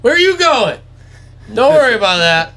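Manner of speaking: very high, loud coaxing call; imitating dad reassuring fleeing deer; sweet-talking. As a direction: sing it out high, as to a pet. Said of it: "be in the woods and be like".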